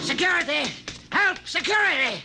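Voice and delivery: panicked cry for help down the corridor; shouting for help. Acted. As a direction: yell it out to bring the guards running. Guard attacked, calling for help.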